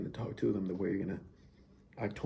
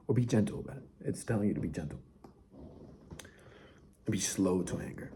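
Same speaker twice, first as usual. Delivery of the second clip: usual speaking voice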